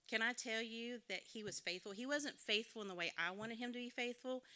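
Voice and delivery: normal talking voice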